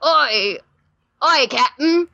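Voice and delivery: eager Cockney pirate reporting for duty; Cockney pirate deckhand. Pretend. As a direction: call up to the captain in a mock Cockney sailor voice. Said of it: "my Dick Jones voice".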